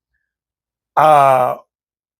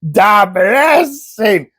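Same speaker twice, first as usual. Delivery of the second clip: slow, drawn-out movie-character imitation; mock exasperated explaining, very slow; Uncle Louis; 2 semitones higher, much slower than usual. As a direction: stretch each word for someone hard of hearing. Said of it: "Louis is like".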